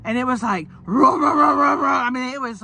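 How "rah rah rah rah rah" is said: loud, higher revving "rah-rah-rah"; imitated engine roar. Telling how stepmom's car started, loud engine imitation. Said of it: "like, so loud".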